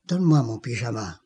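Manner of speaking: voix basse et rapide, plus grave; tout bas. Retold or imitated. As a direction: chuchoter presque sa demande. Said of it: "tout bas". (demande à voix basse à l'infirmière)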